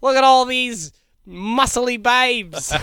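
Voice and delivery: cocky hostel bro voice; mock hostel backpacker. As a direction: lay it on thick as a smug backpacker.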